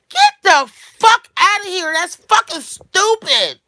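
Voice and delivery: high, loud, stretched shouts; exasperated full-voice outburst; outraged yelling. Real, not acted. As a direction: shout it down in disbelief. Outraged dismissal ending the rant.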